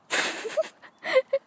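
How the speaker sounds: high, breathless burst of laughter. Real, not acted. Crafter breaks off mid-instruction, high bursting laugh.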